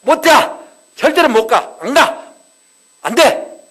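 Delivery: high, loud yelling refusal; wife clutching his trousers, shouting no; desperate shouting. Retold or imitated. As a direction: scream it while clinging on. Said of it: "바지가리 꽉 잡고".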